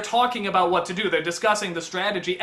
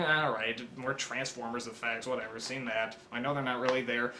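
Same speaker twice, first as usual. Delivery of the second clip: much softer, lower, unimpressed mutter; bored inner voice; 9 semitones lower, 11 dB quieter than usual. Retold or imitated. Viewer dismissing trailer effects, soft unimpressed mutter. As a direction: shrug it off under your breath.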